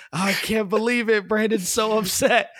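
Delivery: sarcastic whine of fake sorrow; hammed-up mock concern; mock sympathy. Mocking imagined player's pity.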